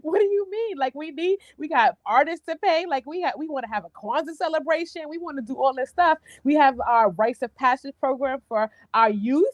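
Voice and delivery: high, fast, alarmed disbelief; disbelieving panic. Retold or imitated. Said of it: "And I was like".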